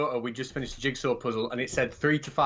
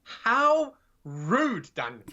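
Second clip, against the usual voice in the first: mock offended; 6 semitones higher, 3 dB louder, much slower than usual. Joke punchline in put-on voice.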